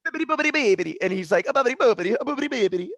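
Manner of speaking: high, loud cartoon Italian gibberish; Peter Griffin fake Italian. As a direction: ham up the Family Guy Italian bit. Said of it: "Peter Griffin in the Italian restaurant".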